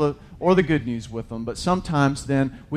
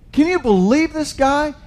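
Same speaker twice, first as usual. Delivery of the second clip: higher, louder disbelief at Jonah; preacher marvels at Jonah to the church; incredulous exclaim; 12 semitones higher, 5 dB louder than usual. Real, not acted. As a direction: throw it out to the room in disbelief.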